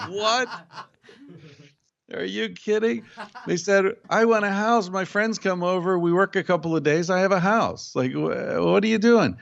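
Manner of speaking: mock shocked visitors; incredulous. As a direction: act stunned by the idea. Tribesmen react to thirty years.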